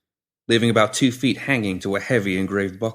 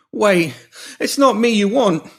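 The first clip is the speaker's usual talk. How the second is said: much higher, louder frantic begging; panicked pleading; 12 semitones higher, 5 dB louder, slower than usual. Acted. Pinky pleading with the woman holding shears, panicked. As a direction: beg for your life, backing away.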